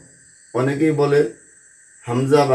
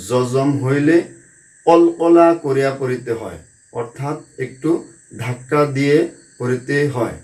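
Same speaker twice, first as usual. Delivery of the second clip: ordinary delivery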